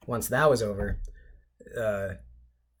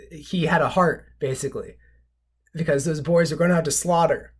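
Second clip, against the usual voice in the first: ordinary talk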